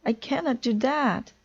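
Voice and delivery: high agitated protest; upset refusal. A narrator's reading. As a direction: insist you simply can't. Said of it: "shouted Toad".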